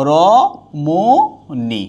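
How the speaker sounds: রমণী drawn out very slowly; slow demo pronunciation. Shown as a demonstration. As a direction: stretch রমণী syllable by syllable. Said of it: "teacher demonstrates pronunciation".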